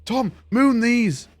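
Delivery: high, drawn-out mock call; egging on a mate. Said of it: "Everyone was like".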